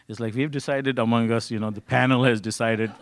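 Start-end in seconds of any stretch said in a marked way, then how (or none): none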